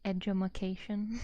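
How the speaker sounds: playful wrong word "edumacation"; funny mispronunciation. Shown as a demonstration. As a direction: say "edumacation" as ed-yoo-muh-KAY-shun, mock dumb. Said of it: "instead of saying education".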